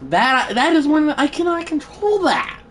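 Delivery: high-pitched exasperated protest; furious. Real, not acted. Gamer protesting what he cannot control, furious. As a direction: insist on it, voice climbing high. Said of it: "I am furious right now".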